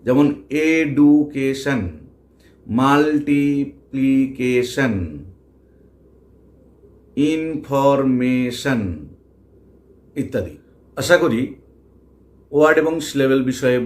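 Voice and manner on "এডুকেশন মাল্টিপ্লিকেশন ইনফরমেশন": এডুকেশন, মাল্টিপ্লিকেশন, ইনফরমেশন very slow, each syllable set apart. Shown as a demonstration. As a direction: break education, multiplication and information into long even beats, like এ-ডু-কে-শন. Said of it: "কেটে কেটে উচ্চারণ করি". (এডুকেশন, মাল্টিপ্লিকেশন, ইনফরমেশন ধীরে, প্রতিটি syllable কেটে কেটে)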